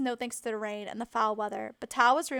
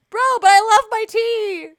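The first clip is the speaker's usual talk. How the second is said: much louder, higher hammed-up cry; mock wail; 10 semitones higher, 19 dB louder than usual. Joking Brit pleading to Kane, loudly wailing. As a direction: overplay the outrage about tea.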